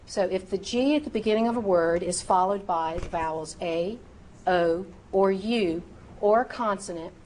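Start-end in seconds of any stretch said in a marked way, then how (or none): none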